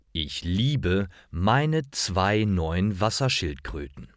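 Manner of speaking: ordinary delivery, nothing marked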